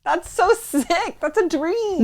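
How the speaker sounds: high, slow, dreamy squeal; gushing excitement. Real, not acted. Gushing over accent after surgery.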